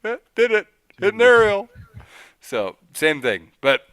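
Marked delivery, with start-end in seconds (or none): 0.0-1.7 very high, playful little boasting voice; self-imitating boast. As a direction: squeal it in mock self-congratulation. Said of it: "I'm like".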